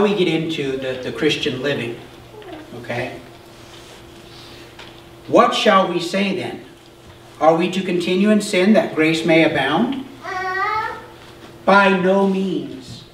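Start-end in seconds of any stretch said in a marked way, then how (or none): 5.3-13.0 scripture reading. Acted. Pastor reading Paul's question to the class, slowing at the end. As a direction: read it out as holy text. Reads scripture passage aloud.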